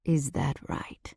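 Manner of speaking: slow, unvoiced mocking whisper; low teasing whisper. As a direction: purr it close to her ear. He doubts her, close up.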